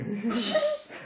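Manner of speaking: squealing high laugh; high giggle. Real, not acted. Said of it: "Ist lustiger".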